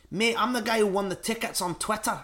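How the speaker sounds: high-pitched, shaky attempt at a Scottish accent; Edinburgh accent. Imitating the fan who won tickets, rough Edinburgh accent. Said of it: "what's an Edinburgh accent?".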